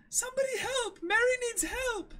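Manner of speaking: high, loud calling out; calling for help. Acted. Kaya calls through the mansion for help for Mary, high and loud. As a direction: cry out for anyone to come.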